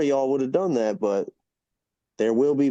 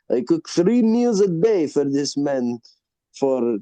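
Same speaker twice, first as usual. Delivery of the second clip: restaurant owner; 2 semitones higher, 3 dB louder, slower than usual. Retold or imitated. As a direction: proudly spell out the claim, pausing before the end. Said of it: "He's like".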